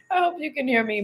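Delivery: amused repeat. Real, not acted. Apologizing to viewers for the train noise, high amused repeat. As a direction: lift it high, just after laughing.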